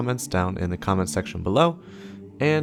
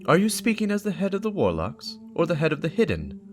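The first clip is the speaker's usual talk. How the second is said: higher, pointed questioning voice; lightly voiced warlock; Aonor voicing; 10 semitones higher than usual. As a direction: raise the voice for Aonor's challenge. Aonor's voice in read story.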